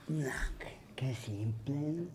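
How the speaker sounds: whisper. Real, not acted. Muttered aside to himself.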